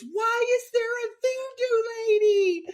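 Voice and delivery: imitated high, wailing shriek; panicked gasp. Imitating Brittany spotting a figure from the car, high panicked wail. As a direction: wail it loudly in mock terror, stretched out. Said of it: "Then she gasps and looks at me and goes".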